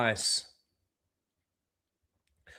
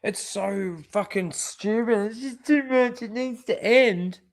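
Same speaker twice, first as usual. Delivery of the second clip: a hint of a whiny mock sob, pitched up; mocking crying; 6 semitones higher than usual. Vlogger mimicking the crying actress, mock whimpering. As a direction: parody her tearful plea. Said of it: "She fucking cries".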